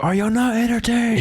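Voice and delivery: high-pitched imitation of an arena yell; mock movie scream. Friend defending the movie line's impact, mock screamed. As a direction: cry it out high, like a film hero. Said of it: "you just screamed it".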